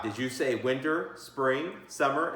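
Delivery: usual speaking voice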